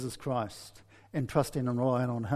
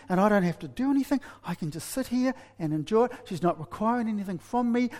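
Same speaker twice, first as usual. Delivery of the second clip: put-on higher, eager inner voice of a delighted shy young man; shy boy thinking; 6 semitones higher than usual. Preacher voicing the shy boy's delighted thoughts. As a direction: play the shy guy thrilled he needn't talk. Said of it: "That's what he's thinking".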